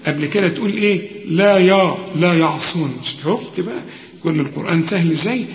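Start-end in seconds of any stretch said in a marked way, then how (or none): none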